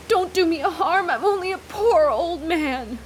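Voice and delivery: put-on hoarse pleading old man; croaking frightened old man. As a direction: croak it out, terrified. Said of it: "he croaked".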